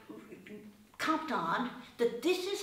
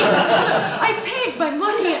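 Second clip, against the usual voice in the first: loud, slow, high, laughing protest; laughing outrage; 10 semitones higher, 14 dB louder, much slower than usual. Retold or imitated. Recalling being questioned, she laughs out a mock-indignant protest.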